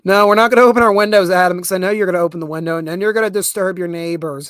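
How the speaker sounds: loud, fast telling-off; real scolding call. Real, not acted. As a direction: call across the room to stop Adam. Scolding Adam off-mic.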